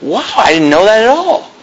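imitated wonder, big swooping pitch; interested surprise. Irish guy learns about the devil, retold amazement. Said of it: "he was actually really interested".

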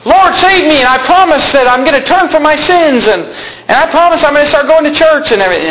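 wrong bargaining plea. Retold or imitated. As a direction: bargain with God desperately. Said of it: "he didn't say".

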